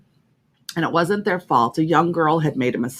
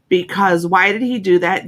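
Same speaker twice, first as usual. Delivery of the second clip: ordinary talk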